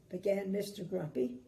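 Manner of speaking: plain reading voice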